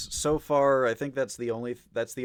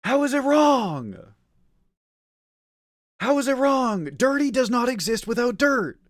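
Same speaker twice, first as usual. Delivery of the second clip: furious shout; 11 semitones higher, 7 dB louder than usual. Real, not acted. Shouting argument about wetness.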